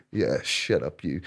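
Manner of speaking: low retold mutter; muttered to himself. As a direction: say it under your breath, low. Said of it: "myself thinking".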